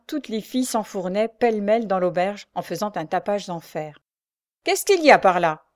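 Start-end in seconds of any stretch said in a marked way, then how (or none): 4.6-5.6 loud, authoritative query; sergeant's questioning. A narrator's reading. Sergeant arrives at a quarrel, loud questioning. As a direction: demand to know, projecting. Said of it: "interrogea le sergent".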